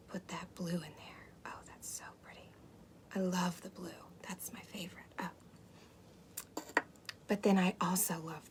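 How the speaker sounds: soft whisper, savoring each colour; ASMR whisper. Acted. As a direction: breathe the words like a secret. Whispers over the cooking.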